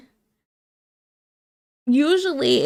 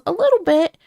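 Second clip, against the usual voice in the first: much higher, louder teasing mom; 9 semitones higher, 6 dB louder than usual. Retold or imitated. Mom agreeing the aunt is scary, high loud tease.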